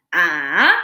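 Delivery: "Ah." dipped low then back up; dipping third tone. Shown as a demonstration. Teacher showing the full textbook third tone, dipping low. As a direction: dip "Ah." down low and curl it up. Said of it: "sounds like this".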